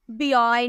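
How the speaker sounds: बॉय read wrongly as "bee-oy"; wrong spelled-out reading. Shown as a demonstration. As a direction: put a separate "bee" before the vowel in बॉय. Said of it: "नहीं बोलते".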